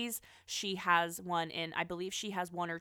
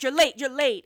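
loud, high mock yell; hammed-up yell at late staff; 6 semitones higher, 13 dB louder than usual. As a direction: yell it at latecomers off the van. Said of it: "He's like".

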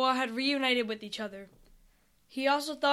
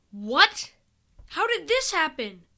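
higher-pitched, startled question; startled disbelief; 4 semitones higher, 3 dB louder than usual. Acted. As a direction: blurt it out in disbelief. Characters find the ocean frozen.

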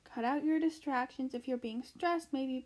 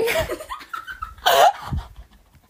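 long, very high laughing fit; 9 dB louder than usual. Real, not acted. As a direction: burst out in loud, high laughter. Cracks up at the joke.

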